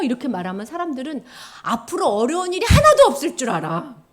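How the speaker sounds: ordinary delivery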